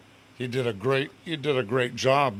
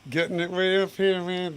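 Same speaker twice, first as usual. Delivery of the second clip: sung-speech pitched high up in the head; head voice demo; 7 semitones higher than usual. Shown as a demonstration. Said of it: "head voice".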